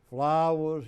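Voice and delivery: calling out in a Southern drawl; loud Southern hail, imitating the governor. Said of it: "he talked real Southern".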